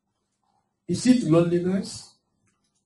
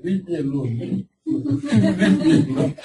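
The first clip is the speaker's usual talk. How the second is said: mock pleading. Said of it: "Some people always say".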